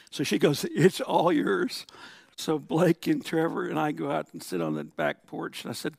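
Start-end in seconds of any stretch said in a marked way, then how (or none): 0.7-1.9 slow, drawn-out, higher and louder; mock handoff. Mom handing dad the sex talk, teasingly drawn out. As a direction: stretch it out as you pass the job over.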